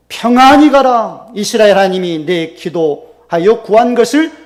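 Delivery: loud, slow priestly pronouncement; solemn blessing. Retold or imitated. Priest Eli sending Hannah off in peace, loud solemn blessing. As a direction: bless her with full authority.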